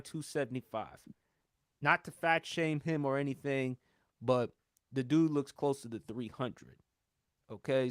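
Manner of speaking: normal talking voice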